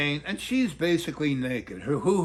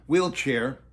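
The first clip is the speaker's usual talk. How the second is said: usual speaking voice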